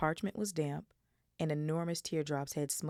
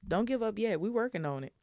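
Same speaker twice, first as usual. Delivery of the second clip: louder, higher fierce insistence; 6 semitones higher, 4 dB louder than usual. A narrator's reading. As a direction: push back hard, don't give up. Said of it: "said Ron fiercely".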